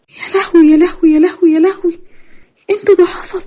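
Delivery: high shocked wailing; panicked disbelief, voice climbing; صدمة وذعر. Acted. هناء تصدم من اعتراف أختها. (صرخة صدمة عالية)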